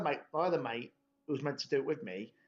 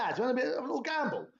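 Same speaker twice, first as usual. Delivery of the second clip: excited; 10 semitones higher, faster than usual. Retold or imitated. Urging the lads to gamble.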